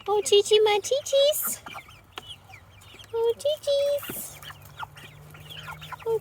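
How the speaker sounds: high, lilting coaxing call to the hens; sing-song chicken call. Real, not acted. Calling chickens to bed.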